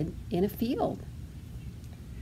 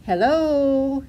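high, louder drawn-out greeting; calling out; 6 semitones higher, 5 dB louder than usual. A narrator's reading. Duckling greets the bugs and dragonflies, calling out. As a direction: call it out in a high voice. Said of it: "she called to the bugs".

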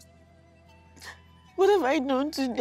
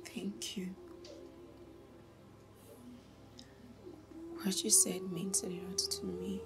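hushed, secret whisper; soft whispered gratitude; whispered thanks; 5 semitones lower, 17 dB quieter than usual. Acted. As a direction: keep it to a breath so the house can't hear. Secret whispered talk with lover.

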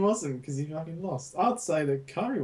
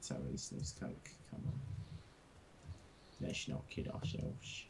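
whispering; 11 semitones lower, 16 dB quieter than usual. Real, not acted. Muttered aside about cocaine.

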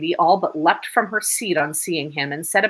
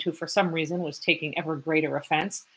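normal talking voice

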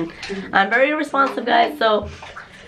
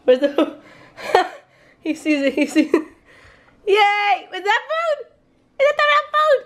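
squeaky high cooing at a pet; cooing at the rat, much higher; pet talk; 11 semitones higher than usual. Real, not acted.

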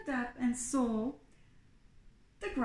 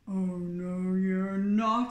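drawn-out troll refusal, swelling louder; big hairy troll; 4 semitones lower, 5 dB louder, slower than usual. A narrator's reading. Troll refusing to let the goat cross, swelling troll voice. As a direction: build to a loud troll NOT.

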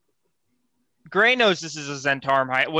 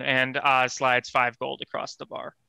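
ordinary talk, nothing marked

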